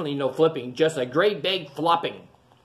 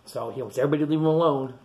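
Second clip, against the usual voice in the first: usual speaking voice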